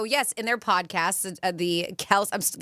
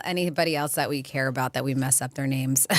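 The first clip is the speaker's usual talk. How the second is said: ordinary delivery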